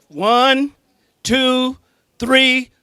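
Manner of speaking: shouted, high countdown to pump the crowd; loud count to three. Real, not acted. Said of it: "when I count to three".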